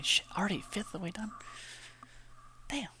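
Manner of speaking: fast, hushed whisper to himself; a quick private whisper of surprise; whispered aside. Real, not acted. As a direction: breathe it out in quick disbelief.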